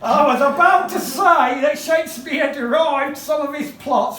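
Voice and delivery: high, hammed-up ranting orator; Charles Dickens. Dickens railing at American gentlemen, high theatrical oratory. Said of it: "do the Charles Dickens impression".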